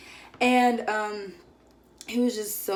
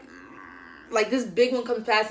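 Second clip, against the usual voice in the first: normal talking voice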